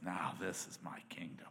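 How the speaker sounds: breathy unvoiced whisper of the Lord. Preacher voicing the Lord to the congregation, whispered. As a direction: whisper it like a sacred secret.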